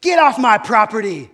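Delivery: mock furious shout. Pastor faking the wrong father's reaction, hammed-up shout. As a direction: bellow it like an angry landowner, then drop it.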